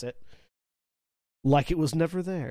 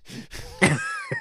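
very high squeal of laughter; high, amused laugh. Real, not acted. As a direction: burst out laughing at a silly accent. Amused by the odd accent.

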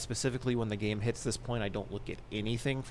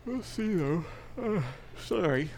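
yawning; 7 semitones higher than usual. Real, not acted.

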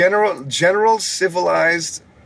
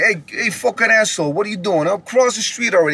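put-on higher, brash, pushy New York accent; 6 semitones higher, faster than usual. Showing a rude New Yorker, a brash New York accent. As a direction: bark it like an angry New York cabbie. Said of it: "That's New York".

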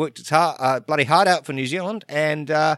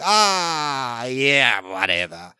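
dismissive retort; 2 semitones lower, much slower than usual. Retold or imitated. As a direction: imitate giving up with a resigned brush-off. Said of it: "I'm like".

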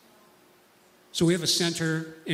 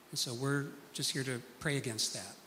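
ordinary delivery

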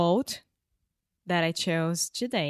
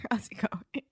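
whisper; 7 semitones higher, 4 dB quieter than usual. Real, not acted. Joking whisper to dead author.

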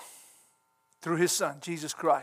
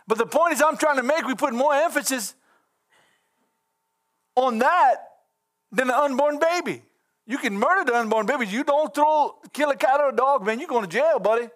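preaching louder and higher, indignant; rising preaching; 7 semitones higher, 3 dB louder than usual. Acted. Preacher railing at a congregation, higher and louder. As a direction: build the sermon up, stirred. Preaching about unborn babies.

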